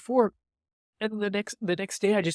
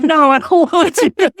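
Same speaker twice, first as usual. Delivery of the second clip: mock loud refusal; 11 semitones higher, 16 dB louder, slower than usual. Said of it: "I'm like".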